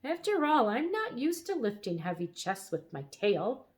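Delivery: high, huffy dragon protesting; indignant, winded grumble; peeved dragon catching his breath after pulling; somewhat cross. A narrator's reading. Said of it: "said the dragon somewhat crossly".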